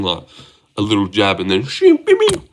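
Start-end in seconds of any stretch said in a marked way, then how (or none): 1.6-2.5 high-pitched vocal sound effects; imitated strike sounds. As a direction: mimic quick punches with squeaky noises. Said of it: "something like that".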